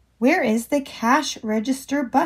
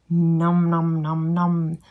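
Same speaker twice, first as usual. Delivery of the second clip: low munching nom nom; 7 semitones lower, slower than usual. Pretend. Voicing eating sounds for child.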